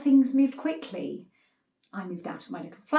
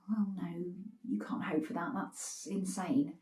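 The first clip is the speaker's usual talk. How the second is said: much softer, lower self-correction; hushed backtrack; 3 semitones lower, 12 dB quieter than usual. Acted. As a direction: quietly take it back, as if to yourself. Backtracks on a shocking wish.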